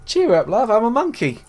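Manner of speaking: high, loud mock-London monkey; exaggerated Cockney. Joining in mocking the monkey's voice, hammed-up Cockney. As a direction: ham up a chirpy Cockney, pitched right up. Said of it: "most London as London possible".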